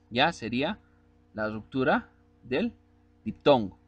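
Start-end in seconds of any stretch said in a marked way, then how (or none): none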